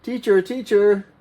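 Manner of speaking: high eager "Teacher, teacher"; calling student. Shown as a demonstration. Student calling the teacher, high and eager. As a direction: call out to get the teacher's attention. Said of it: "what if you have a question".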